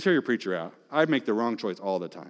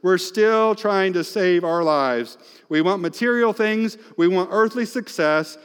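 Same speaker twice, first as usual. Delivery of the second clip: ordinary talk, nothing marked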